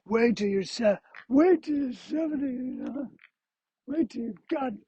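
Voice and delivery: put-on high, strained old-timer voice; old person. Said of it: "somebody who's seventy is like".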